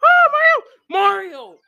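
very high, loud yell at Mario; frustrated outcry. Real, not acted. Player to Mario as he fails, frustrated outcry. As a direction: cry out sharply in dismay. Upset at Mario failing in game.

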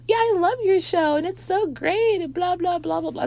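high, sugary fake praise; fake gushing. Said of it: "she's egging it on like".

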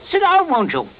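high, loud jovial invitation; hearty hospitality. Acted. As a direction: play the genial host. Host playing hearty before wife.

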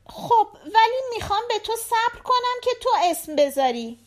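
put-on high-pitched little girl chatting to her dad; little daughter. A narrator's reading. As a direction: voice a young daughter, pitched well up. Narrator voices the daughter. (صدای زیر دختربچه)

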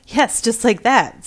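excited. A narrator's reading. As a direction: pounce on the cat's meow. Sexton confirms excitedly.

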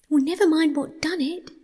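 high, fretful mole voice; mole in pain. A narrator's reading. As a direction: dismiss it crossly through the pain. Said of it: "forgetting his grammar in his pain".

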